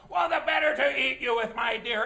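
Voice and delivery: put-on loud wolf voice threatening the girl; menacing wolf. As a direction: bark it out like the wolf about to pounce. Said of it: "he had the audacity to say".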